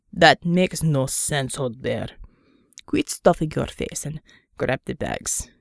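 low, slow, weary gruff man. Acted. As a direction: drag it out, low and tired. Unter scolds the bear.

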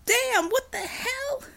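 put-on a huge teen howling in protest; roar. Overgrown kid roars at the heroes, slow and high. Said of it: "the overgrown kid roared".

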